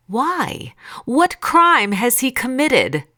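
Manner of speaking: high, incredulous questioning; challenging. A narrator's reading. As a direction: challenge the mob, drawing out the question. Pilate challenges the shouting crowd.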